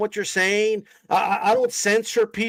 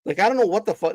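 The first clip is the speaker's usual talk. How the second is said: ordinary delivery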